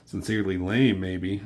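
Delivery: usual speaking voice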